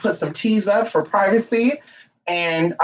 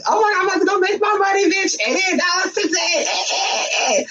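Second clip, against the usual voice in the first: imitating her own excited, higher chatter; giddy self-imitation; 11 semitones higher than usual. On the phone walking to work, giddy and fast. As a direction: squeal it fast, much higher, like money's coming. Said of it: "imagine me".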